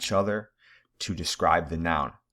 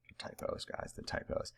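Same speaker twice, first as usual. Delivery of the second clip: whispered aside; 14 dB quieter than usual. Real, not acted. Groaning about slide typos.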